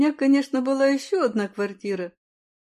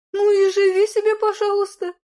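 graciously permitting; 9 semitones higher, 5 dB louder than usual. Acted. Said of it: "милостиво разрешила Людмила".

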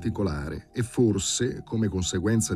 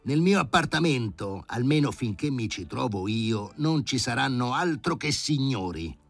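higher, raised indignant tirade; angry professor; 6 semitones higher than usual. Acted. The professor threatening Sharikov, higher and indignant. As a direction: threaten to throw him out. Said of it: "gridò Filippo Filippovic".

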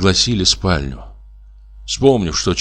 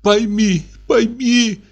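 much higher, slow, sobbing plea; hysterical sobbing plea; 14 semitones higher, much slower than usual. Acted. Wife pleading with Pyotr, much higher slow sobbing plea. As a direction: beg him through tears.

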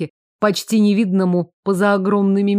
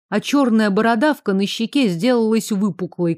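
usual speaking voice

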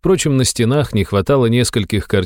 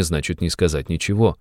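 ordinary delivery

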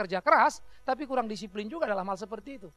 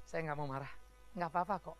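calm self-control; 7 semitones lower, 9 dB quieter than usual. Retold or imitated. Calm trained man speaking.

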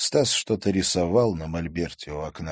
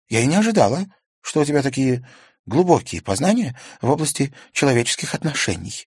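surprised; 7 semitones higher, 5 dB louder than usual. A narrator's reading. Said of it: "удивлённо глядя на Стаса".

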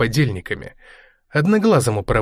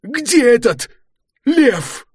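high, loud furious cursing; sputtering foiled villain; 13 semitones higher, 3 dB louder, much slower than usual. A narrator's reading. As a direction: yell in helpless rage. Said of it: "выругался в бессильной злобе".

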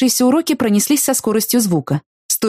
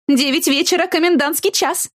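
mocking taunt; 10 semitones higher than usual. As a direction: drag out the mockery. Rival keeps mocking.

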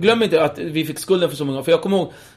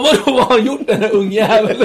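higher, louder dad, laughing; angry dad; 5 semitones higher, 5 dB louder than usual. Retold or imitated. Dad to cops, higher and louder. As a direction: rant at the brat son. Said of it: "Och farsan bara".

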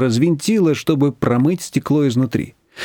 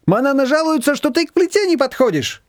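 put-on high, lively older woman; Shushana's voice; 12 semitones higher than usual. A narrator's reading. Higher voice for Shushana reproaching Nina. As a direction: voice her bright and teasing.

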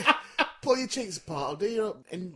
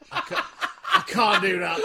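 high, laughing mock panic; teasing imitation of a friend catching himself, pitched up; mimicking his friend's thought; 13 semitones higher, 7 dB louder than usual. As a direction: put on a squeaky "I can't" of the friend. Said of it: "and then you thought".

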